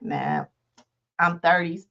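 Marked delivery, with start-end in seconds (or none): 0.0-0.4 dismissive nah. Retold or imitated. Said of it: "I'm like".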